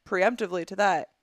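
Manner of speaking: normal talking voice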